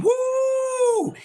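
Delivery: high falsetto whoop; high whooping cheer; excited woo. Real, not acted. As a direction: let out a high whoop of delight. Excited about the fight.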